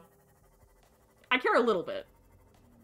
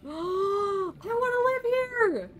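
high, slow stretched-out yell; drawn-out shout; 8 semitones higher, much slower than usual. Real, not acted. Streamer yelling her wish out slowly to the planet.

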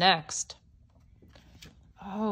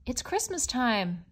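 ordinary delivery, nothing marked

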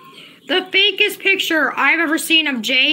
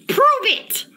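high, sharp demand; real heated dare; angry challenge; 9 semitones higher than usual. Real, not acted. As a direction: throw the dare hard at him. Daring the impostor.

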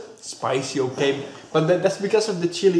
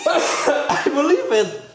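laughing; 8 semitones higher, much slower than usual. Real, not acted. Laughing through the spicy burn.

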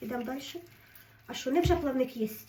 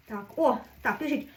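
normal talking voice

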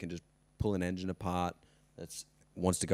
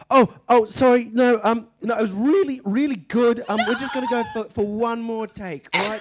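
much higher, louder, fake-cheerful backpedal; sheepish director; 11 dB louder than usual. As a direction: cover up the swearing with forced cheer. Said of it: "And he'd be like".

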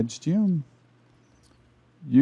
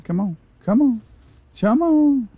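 rising urging; 10 semitones higher than usual. Real, not acted.

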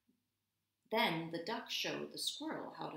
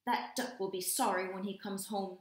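ordinary delivery

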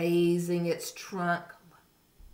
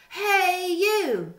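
high, loud squeaky challenge; grouchy ladybug; 13 semitones higher, 9 dB louder than usual. A narrator's reading. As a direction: pick a fight in a tiny high voice. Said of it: "said the grouchy ladybug".

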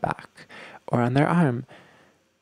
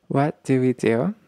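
normal talking voice

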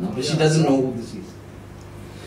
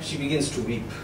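barely getting the words out through real emotion; choked up; 10 dB quieter than usual. Real, not acted. Preacher at a majlis, overcome while telling the story, choking up. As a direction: voice dropping away, moved to tears. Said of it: "I just find it difficult sometimes".

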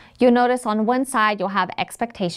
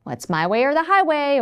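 rigid teacher; 5 semitones higher than usual. Retold or imitated. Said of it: "you might hear things like".

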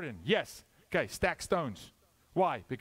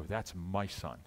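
proud father at his son's touchdown, lower voice; proud football father; 6 semitones lower than usual. Acted. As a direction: lower proud dad boasting. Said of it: "football father stand on the son and go".

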